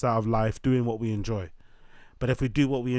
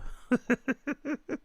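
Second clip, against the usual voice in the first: high laugh; 13 semitones higher, 5 dB quieter than usual. Real, not acted. Laughing at own bit.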